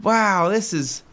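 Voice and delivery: high, drawn-out wonder; mock-impressed older customer; imitated amazement. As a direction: marvel like an awestruck first-timer. Imitating customer marvelling at place.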